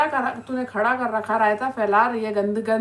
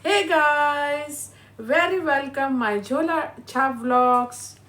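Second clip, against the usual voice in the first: drawn-out mock vlog greeting; slow, hammed-up "hey guys" opener; mocking vlogger intro; 2 semitones higher, much slower than usual. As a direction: imitate a vlogger's intro, stretching the words out slowly. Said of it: "सबसे पहले इसने जो vlog डाला, उसमें डाला".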